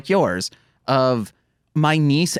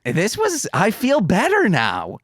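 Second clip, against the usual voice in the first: mock cheery sign-off; 7 semitones higher than usual.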